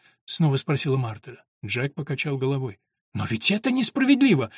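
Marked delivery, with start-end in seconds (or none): 3.1-4.5 put-on high-pitched little girl's protest; indignant, high outburst; indignant exclamation. A narrator's reading. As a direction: cry out at the unfairness, voice raised. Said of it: "возмущённо воскликнула девочка".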